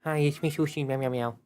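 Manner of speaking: a hint of a cat voice with quick meows; voice of cat Sushi. Streamer speaking for the cat saying hi, light cat voice. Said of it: "Sushi, say hi".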